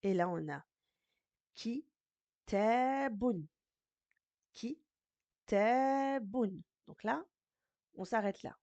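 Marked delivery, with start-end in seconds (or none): 1.5-3.5 "te" held long, higher and louder; short "ki", stretched "te", then "bun"; long middle vowel. Shown as a demonstration. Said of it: "elle a une longue voyelle". 4.5-6.6 "te" held long, higher and louder; short "ki", stretched "te", then "bun"; long middle vowel. Shown as a demonstration. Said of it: "elle a une longue voyelle".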